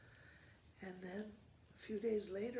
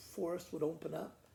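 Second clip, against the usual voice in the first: ordinary delivery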